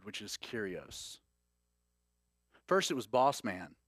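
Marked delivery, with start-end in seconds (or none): none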